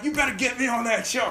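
high, excited mock demand from the friend. Friend demanding a spot on the show, high excited. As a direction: squeal it like a jealous buddy.

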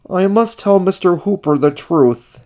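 high, resolute character voice; high-pitched puppet-like determination; Ernie's voice. A narrator's reading.

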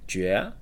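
jue with the tone rising; second tone demo. Shown as a demonstration. Teacher giving the J example, demonstrating jue. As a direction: lift jue upward in one syllable. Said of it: "J U E with the second tone".

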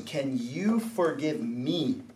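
low, slow, soft apology; acted contrite plea, hushed and slow; humble request. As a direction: ask forgiveness gently, drawing it out. Models asking that person for forgiveness.

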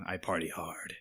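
low, soft and sultry. Pretend. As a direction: drop into a low sultry murmur. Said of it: "you use your sultry voice too".

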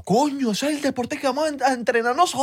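high-pitched, hammed-up Zulia local; high mock excitement; excited wannabe athlete. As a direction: exclaim it high, as a sudden big revelation. Voices excited would-be hashing athletes.

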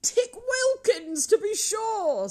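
high, loud, drawn-out exclamation; delighted recognition. A narrator's reading. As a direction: exclaim on spotting an old friend. Scrooge spots his old friend.

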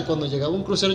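ordinary talk, nothing marked

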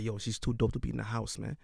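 low-key impression of a younger brother; imitating little brother. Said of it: "Do it in his voice".

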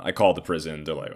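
ordinary delivery

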